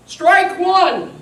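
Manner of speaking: high, loud umpire's call. A narrator's reading. The umpire calling the first strike, loud call. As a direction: sing it out like an umpire. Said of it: "the umpire said".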